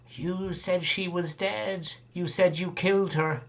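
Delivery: put-on high, slow, accusing child voice; plaintive child calling out a broken promise; lava child. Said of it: "it's one of the lava children".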